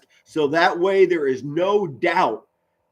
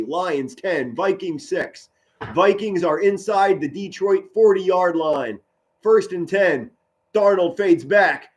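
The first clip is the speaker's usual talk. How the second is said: higher, driving sportscaster call; play-by-play commentary; 3 semitones higher than usual. Acted. Streamer calling the Lions game for viewers, broadcaster style.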